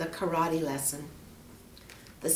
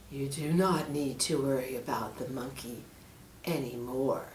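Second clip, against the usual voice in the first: lower, softer sinister snake; menacing snake; 4 semitones lower, 6 dB quieter than usual. Acted. Snake hints at the monkey's fate, low. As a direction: let the threat hang quietly.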